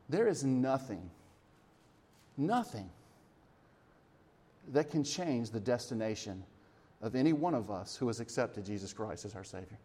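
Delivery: soft, low and solemn; quiet preacher's reassurance, with weighty pauses; hushed assurance. Real, not acted. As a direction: drop the voice for a grave promise.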